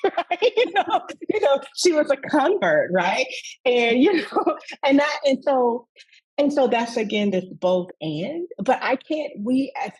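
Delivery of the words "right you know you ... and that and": real laughter, much higher and louder; laughing. Real, not acted. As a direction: laugh through the words. Laughing while telling.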